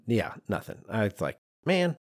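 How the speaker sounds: usual speaking voice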